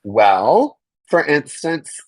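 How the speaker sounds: ordinary talk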